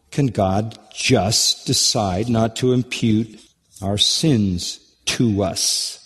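ordinary delivery

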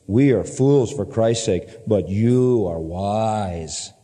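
slow, heavily stretched mock praise; sarcastic, lingering on each flattering word; dripping sarcasm. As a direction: drag out the compliments sarcastically. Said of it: "All dripping sarcasm".

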